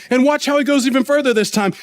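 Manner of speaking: ordinary delivery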